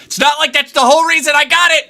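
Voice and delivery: high, loud sarcastic outburst; frustrated yell. Real, not acted. Streamer to chat about the camera, sarcastic yell. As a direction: burst out in exasperation.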